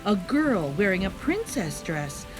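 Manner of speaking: usual speaking voice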